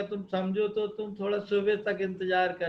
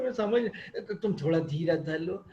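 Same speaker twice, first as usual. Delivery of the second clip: usual speaking voice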